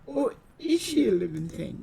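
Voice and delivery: normal talking voice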